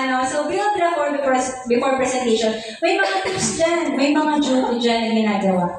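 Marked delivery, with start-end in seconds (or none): none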